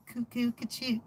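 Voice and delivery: high, bird-like cuckoo clock call. Pretend.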